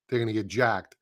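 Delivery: normal talking voice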